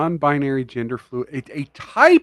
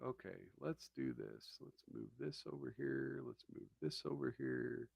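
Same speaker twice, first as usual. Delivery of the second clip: muttering; 25 dB quieter than usual. Real, not acted. Muttering to self off-mic.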